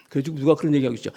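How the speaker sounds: ordinary talk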